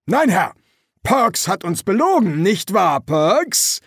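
loud, high parade-ground voice; forceful sergeant. Acted. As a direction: call the recruit out before the officer. Feldwebel stellt Rekrut bloß. (laute, hohe Feldwebelstimme)

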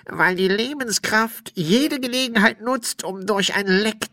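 high-pitched put-on spirit voice; explaining ghost.

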